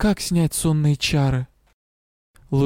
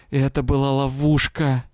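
usual speaking voice

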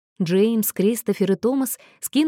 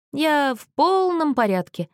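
put-on slow slurred drunk voice, higher; town drunk; 7 semitones higher than usual. A narrator's reading. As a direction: drag the words out, tongue tripping. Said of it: "заплетающимся языком повторил Мэтью".

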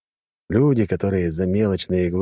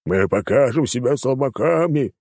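shouting; 6 semitones higher than usual. Acted. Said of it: "послышались ещё крики".

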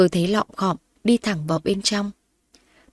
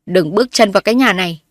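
loud, stern barring order; father angrily barring his daughter at the door; angry command; 6 dB louder than usual. Acted. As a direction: stop her cold with fury.

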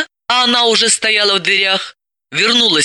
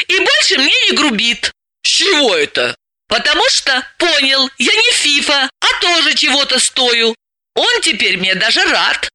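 put-on higher girlish voice, arguing back; Dalila, young and stubborn; 8 semitones higher than usual. Dalila answers her bossy friend, higher and argumentative. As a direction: push back with a lively counter-argument.

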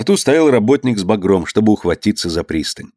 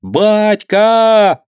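high, loud, drawn-out call; boy shouting; 12 semitones higher, 5 dB louder, much slower than usual. Acted. Boy calls his father's boat from the bank, shouting. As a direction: keep calling out to father. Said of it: "кричал Орёлка, не переставая".

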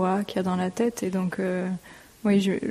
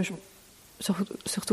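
whisper; 6 dB quieter than usual. Real, not acted. Shy aside about childhood.

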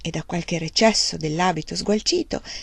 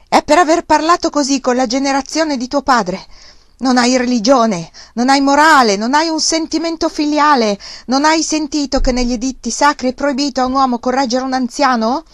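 loud, higher scolding tirade; shouting; 7 semitones higher, 7 dB louder than usual. A narrator's reading. Uncle scolds nephew for disrespecting elders, loud. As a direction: berate him at full voice. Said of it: "gridò".

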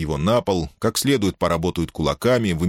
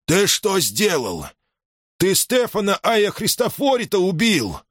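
высокий, протяжный укоряющий возглас; обвиняй племянника высоким голосом, в смятении; потрясённый крик; 12 semitones higher than usual. A narrator's reading. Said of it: "закричал Иоанн Дука". (потрясённо, криком)

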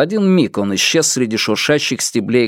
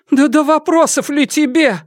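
put-on high, anxious old mother; worried. A narrator's reading. Mother-in-law fretting over the hunted man, high worried voice. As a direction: fret at him in a high, urgent voice. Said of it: "озабоченно проговорила матушка".

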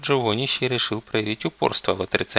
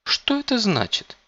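put-on high indignant girl's question; displeased girl; 13 semitones higher, 3 dB louder than usual. A narrator's reading. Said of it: "недовольный женский голос".

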